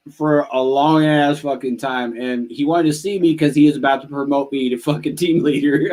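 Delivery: ordinary talk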